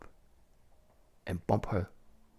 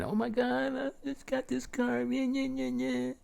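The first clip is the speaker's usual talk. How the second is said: much higher, squeaky mock-crying woman; 4 dB louder than usual. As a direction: mimic her wailing in a high put-on voice. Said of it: "And she's crying".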